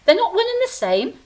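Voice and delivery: startled protest, voice jumping up; surprised disbelief. Acted. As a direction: blurt it out in shock. Disbelief at Lucifer claim.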